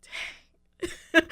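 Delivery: breathy, high little dang; stunned dang. Real, not acted. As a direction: let it slip out, hit hard. Reacts to being asked okay.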